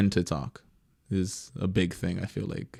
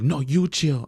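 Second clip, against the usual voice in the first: higher-pitched mock retort; retold angry retort; 6 semitones higher, 4 dB louder, slower than usual. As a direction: throw it back as in a retold quarrel. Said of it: "And I yell at this lady".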